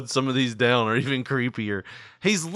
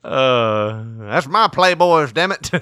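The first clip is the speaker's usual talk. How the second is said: mock angry outburst, louder and slower; angry old barber; 6 dB louder, slower than usual. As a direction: play the grumpy barber scolding a thief. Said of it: "Mister Roy got pissed".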